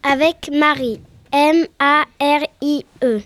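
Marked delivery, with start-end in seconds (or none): 1.3-3.2 each letter of L E B R A C said separately; spelled out letter by letter. Shown as a demonstration. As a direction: spell out L E B R A C one letter at a time. Epelle le mot de l'acrostiche. (épelé lettre par lettre)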